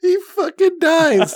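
high and slow disbelieving exclaim; incredulous exclaim. Real, not acted. Hearing how the story ends, an incredulous high outburst. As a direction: blurt it out in delighted disbelief. Laughing at ending.

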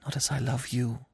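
high, very soft declaration of love; hushed, tender woman's voice; whispered. A narrator's reading. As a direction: murmur it intimately, barely voiced. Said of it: "she whispered".

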